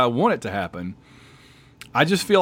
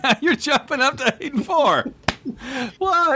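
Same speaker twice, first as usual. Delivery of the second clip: mock outrage; 11 semitones higher than usual. Real, not acted. Mock outrage at partner's switch.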